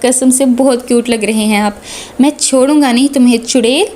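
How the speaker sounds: usual speaking voice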